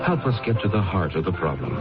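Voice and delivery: usual speaking voice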